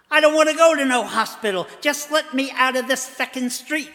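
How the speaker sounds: loud high boozy Boston woman; drunk old woman. Acted. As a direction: rant like an angry old drunk. Sophie refuses, voiced.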